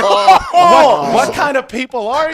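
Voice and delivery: put-on very high, loud indignant old lady shriek; outraged old lady. Old lady scolds the guests who kicked her dog, high indignant shriek. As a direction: shriek it like a scandalised hostess.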